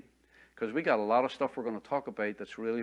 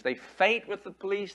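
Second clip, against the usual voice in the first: normal talking voice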